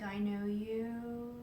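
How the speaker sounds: drawn-out, spoken aloud; slow, voiced recognition. Repeating her recognition to the figure, slow and voiced. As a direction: say it slowly at normal volume, each word weighted.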